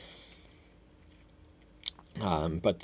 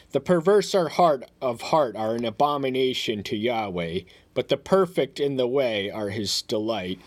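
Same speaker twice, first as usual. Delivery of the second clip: higher, louder, solemn proclamation of the verse; scripture reading; 3 semitones higher, 4 dB louder than usual. Acted. Reads scripture aloud.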